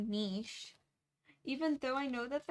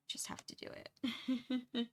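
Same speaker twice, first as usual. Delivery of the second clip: whispering; 3 semitones higher than usual. Real, not acted. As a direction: breathe the words out in a whisper. Excited talk of painting plans.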